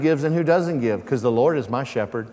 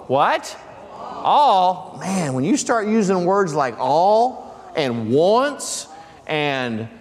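building preaching climax; 6 semitones higher, slower than usual. Acted. Rising excited preaching.

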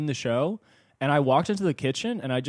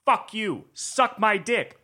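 high, drawn-out taunt; slow, high jeering chant; acted heckling; 7 semitones higher, much slower than usual. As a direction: stretch each insult out like a heckler, pitched up. Acts out heckling a man's arrival.

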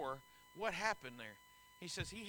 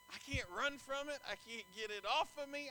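normal talking voice

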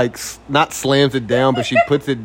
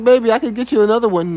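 quick soothing offer; 10 semitones higher, 4 dB louder, much faster than usual. Retold or imitated. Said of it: "And I was like".

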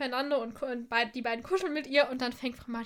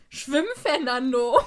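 louder, slow shouted call to a horse; mock call out; 8 dB louder, slower than usual. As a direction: call out to the drowning horse, drawn out. Someone calls out to Fernando.

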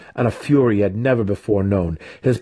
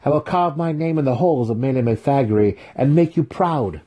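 higher-pitched youthful pledge; earnest promise; 6 semitones higher than usual. A narrator's reading. Student vowing to make his mentor proud, eagerly. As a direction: vow it eagerly to your teacher. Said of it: "Drizzt promised".

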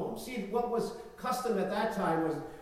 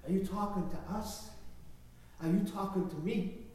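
De Niro impression; 6 dB quieter than usual. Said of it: "And Robert De Niro time".

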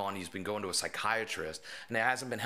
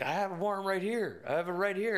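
mock officer; 11 semitones higher than usual. Officer bluffing with paper.

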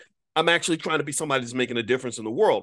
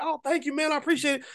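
mock calling back; 13 semitones higher, faster than usual. Thanking a fan across the street, mock calling back. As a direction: imitate a holler without the volume. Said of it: "we were yelling back and forth".

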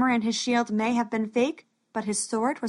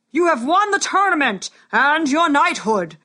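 loud, higher royal proclamation; booming warrior king; 5 semitones higher, 6 dB louder than usual. Acted. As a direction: proclaim it to the whole arena. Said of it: "thundered the king".

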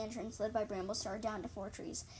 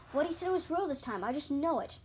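louder, higher, voiced insistence; insistent hiss; 5 semitones higher, 6 dB louder than usual. A narrator's reading. Apprentice cat arguing to be believed, hissed insistence. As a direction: insist on it, raised and pressing. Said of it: "I hissed".

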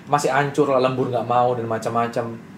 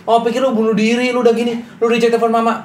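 high, louder imitation of a worried mother; high mom voice; 10 semitones higher, 5 dB louder than usual. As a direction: play the fretting mom, pitched up. Mom's complaint voiced high. (suara ibu yang cemas, tinggi dan agak keras)

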